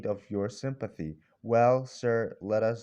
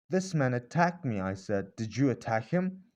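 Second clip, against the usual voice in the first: plain reading voice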